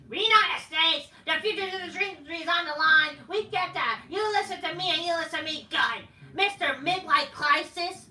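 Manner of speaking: a mocking imitation of a cocky wrestler's promo. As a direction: ham up his promo to ridicule it. Mocking Rollins promo lines.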